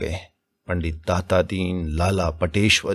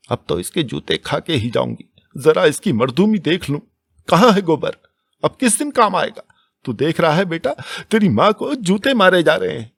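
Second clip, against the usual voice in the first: high, louder anguished wail; wailing lament; 9 semitones higher, 4 dB louder than usual. A narrator's reading. Dhaniya wailing to the village that her son should see, high and loud. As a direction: cry out to the whole village in anguish. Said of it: "आर्त स्वर में बोली".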